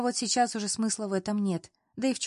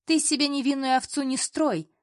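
higher-pitched, angry accusation; spoiling for a fight; 6 semitones higher, 4 dB louder than usual. A narrator's reading. Colleague set on a scandal with the heroine, combative. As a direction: snap at her, itching for a scandal. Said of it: "Элина была настроена на скандал".

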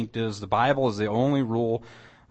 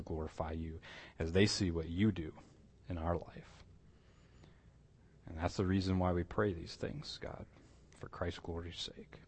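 softer, lower prayer voice that sinks quieter toward the end; closing prayer; 3 semitones lower, 4 dB quieter than usual. Acted. Pastor prays over his class, soft earnest prayer. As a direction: pray it earnestly and hushed.

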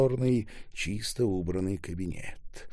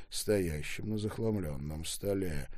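ordinary delivery

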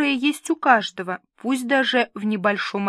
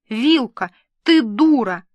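louder, sharp cry of Вилка, ты дура; exasperated insult; 2 semitones higher, 6 dB louder than usual. Acted. Milka snapping at her friend, loud exasperated insult. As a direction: fling the words out in exasperation.

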